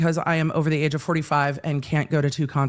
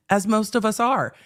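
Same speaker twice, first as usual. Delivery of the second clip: ordinary talk, nothing marked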